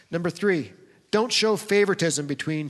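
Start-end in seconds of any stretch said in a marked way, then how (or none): none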